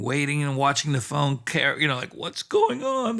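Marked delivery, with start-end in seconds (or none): 2.1-3.2 high, slow fretful question; anxious wondering. Retold or imitated. Driving and watching the silent phone, anxious wondering. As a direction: fret aloud, drawn out and high. Fretting while waiting for a call.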